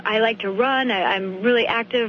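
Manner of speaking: ordinary talk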